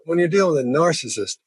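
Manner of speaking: narcissist with a hard, stressed N; narcissist, start the N crisp and strong. Shown as a demonstration. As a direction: punch the N of narcissist. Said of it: "it's that hard in".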